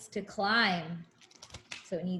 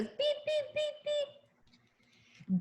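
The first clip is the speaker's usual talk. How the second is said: truck beeping sound; 6 dB quieter, much slower than usual. Retold or imitated. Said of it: "he goes".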